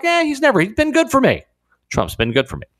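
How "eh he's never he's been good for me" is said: high-pitched, dismissive imitation. Host voices rapper now warming to Trump, high mock quote. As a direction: wave off old objections in a high mock voice. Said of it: "is now like".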